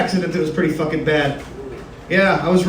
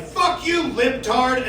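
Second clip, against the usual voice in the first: higher, softer mock insult; a hint of an angry troll; internet troll; 4 semitones higher, 6 dB quieter, much slower than usual. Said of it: "they'll hit you with some shit like".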